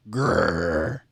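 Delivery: a low, grumpy growl through the teeth; low cranky growl. Acted. Sour grape growls.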